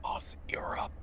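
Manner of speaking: breathy soft whisper; hushed wake-up whisper. Real, not acted. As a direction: whisper to rouse him. Whispered call.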